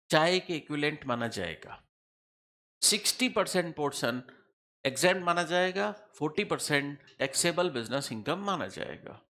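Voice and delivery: ordinary talk, nothing marked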